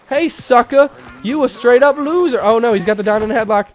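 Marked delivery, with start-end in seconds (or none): 0.1-2.4 much higher, louder trash talk; hammed-up street taunt; mock taunt. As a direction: jeer at him. Taunting the rival.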